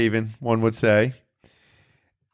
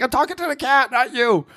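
mock high retort; 11 semitones higher than usual.

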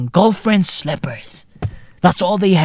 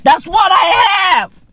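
high excited repeat; 9 semitones higher, 3 dB louder, slower than usual. Acted.

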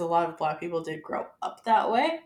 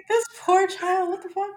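high, laughing pity; half-laughing at the poor child; laughing disbelief; 12 semitones higher, 3 dB louder than usual. Retold or imitated. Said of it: "I was like".

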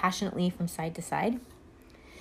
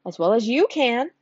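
boastful flower; 5 semitones higher, 8 dB louder than usual. Acted. Tiger Lily boasting.

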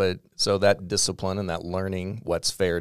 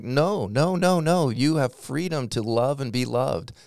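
passionate protest; 7 semitones higher, 3 dB louder than usual. Retold or imitated. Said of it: "And I'm like".

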